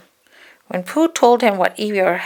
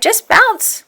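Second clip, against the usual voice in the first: very high and loud; spring it like a bounce; bouncy Tigger; 7 dB louder than usual. Acted. Tigger's advice.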